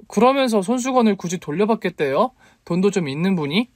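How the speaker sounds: high, indignant question; incredulous challenge. A narrator's reading. 차 있는 남자에게 따짐. (어이없다는 듯 높은 목소리로)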